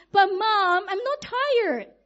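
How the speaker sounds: put-on high, slow, insistent child voice; wide-awake child. Daughter protests to her tired mom, high and insistent. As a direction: plead it like a child who won't sleep. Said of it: "my child will say".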